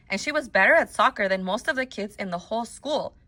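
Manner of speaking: ordinary delivery, nothing marked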